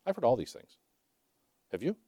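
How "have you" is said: ordinary delivery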